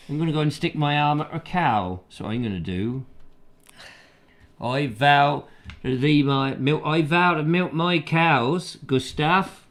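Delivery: put-on farmer's country English accent; regional English accent. Local telling Gustav he'll milk his cows, in country English accent. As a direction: play a cow-milking local in regional English. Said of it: "if he was down there in the".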